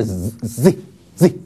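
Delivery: "Z" as a heavy ks from the throat; throaty X sound. Shown as a demonstration. Teacher contrasts throat X with lip S, throaty "Z". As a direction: push air from the throat into "Z", like X. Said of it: "是喉咙的Z".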